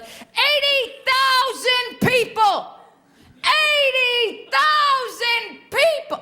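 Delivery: high, slow, hammed-up yell; screaming grandfather. Grandfather at the baseball game, mock screaming high. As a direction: bellow it like a grandpa losing his mind. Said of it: "he starts screaming at the top of his lungs".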